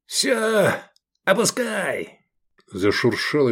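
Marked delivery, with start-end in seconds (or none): 0.0-2.2 urgent call. A narrator's reading. Rescuer orders the rope lowered, high urgent call.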